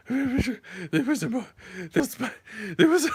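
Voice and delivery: stuck on the words, too frightened to speak; panicked stammer. Retold or imitated. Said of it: "I couldn't even talk".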